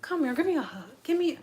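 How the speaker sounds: soft, soothing mock deflection; cool, collected coaxing. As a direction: coax quietly, as if nothing happened. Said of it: "most cool and collective ass voice".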